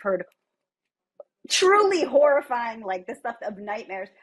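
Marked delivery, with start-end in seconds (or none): 1.5-2.8 higher, louder, slow "truly horrifying"; dramatic emphasis. Acted. Dramatic emphasis on bad mistakes.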